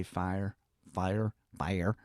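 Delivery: "fire" with a soft, drawn Southern i. Shown as a demonstration. Southerner showing he cannot say fire, soft Southern vowel. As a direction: say "fire" the way a Deep South speaker can't help saying it. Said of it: "I cannot pronounce the word F I R E".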